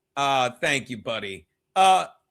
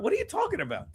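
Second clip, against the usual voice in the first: usual speaking voice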